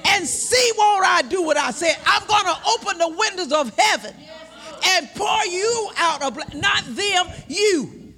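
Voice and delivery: slow, booming voice of God; slow thundering divine command; preaching God's voice. Retold or imitated. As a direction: proclaim it slowly with full force. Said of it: "He said".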